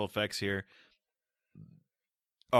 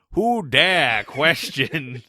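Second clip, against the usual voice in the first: very loud, drawn-out, higher-pitched proclamation; booming voice of God; 4 semitones higher, 10 dB louder, much slower than usual. Acted. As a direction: thunder it slowly like an offended deity. Voicing an affronted God figure.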